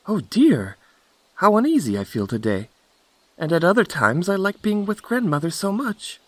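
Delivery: put-on high, anxious little girl voice; uneasy Little Red Cap. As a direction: fret aloud in a high girlish voice.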